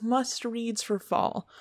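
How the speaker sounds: ordinary delivery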